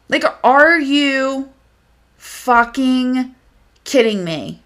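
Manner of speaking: incredulous, with a pause between phrases; slow disbelief. Real, not acted. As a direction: space it out word by word, appalled. Appalled, repeats her disbelief.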